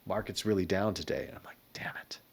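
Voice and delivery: hushed, low-volume retold exchange; retold whispering. Founder retelling the bankers' office as markets crashed, hushed. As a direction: drop to a near whisper, fading on the curse. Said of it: "in and out of the room whispering".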